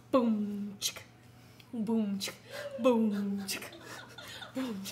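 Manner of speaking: mouth-drum boom chick; beatboxing. Pretend. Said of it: "We're freestyle rapping".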